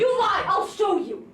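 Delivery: loud, slow challenge; angry threat. Acted. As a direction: shout him down, slowly. Neighbours quarrel over the meadow.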